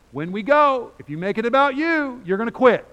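high, booming preacher's shout; sudden full-force pulpit yell; shouted warning. Real, not acted. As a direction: belt it out, high and very loud.

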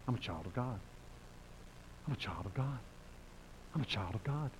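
very soft, repeated with pauses; hushed affirmation. Real, not acted. Preacher telling why he faces rejection, hushed affirmation. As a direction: say it quietly, as if to yourself.

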